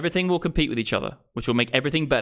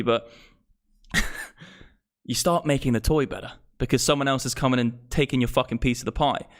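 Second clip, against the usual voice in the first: usual speaking voice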